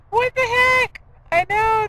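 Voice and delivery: high, startled what-the-heck; surprised. Real, not acted. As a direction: react in startled disbelief. Reacts to the cat.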